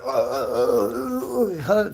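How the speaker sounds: long high grunts of mock exertion; straining groans. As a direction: groan as if buckling under a heavy load. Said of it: "struggling to fucking hold that shit up".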